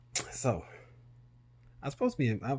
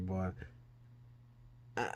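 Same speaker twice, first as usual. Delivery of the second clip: usual speaking voice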